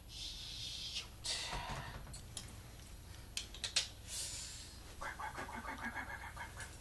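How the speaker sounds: whispered panic, repeated fast; panicked whisper. Real, not acted. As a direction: hiss the curses under your breath. Panicked at too much glue.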